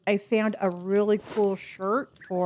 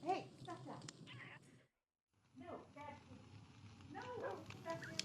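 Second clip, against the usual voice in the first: high, distant scolding; scolding a pet; 8 semitones higher, 26 dB quieter, faster than usual. Real, not acted. Streamer turns from chat to correct the dog, scolding. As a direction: call the dog off, away from the mic.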